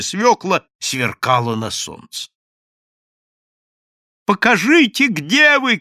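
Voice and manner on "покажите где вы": high, loud call over a crowd. A narrator's reading. Польский calls out over the crowd, loud and high. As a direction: shout to be heard above the crowd. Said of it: "крикнул Иоанна Польский".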